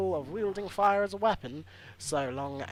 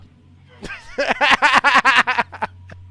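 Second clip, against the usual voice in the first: high cackling laugh; loud burst of laughter; 11 semitones higher, 18 dB louder than usual. Real, not acted. Streamer laughing at own joke, in a loud high laugh. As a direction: crack up loudly.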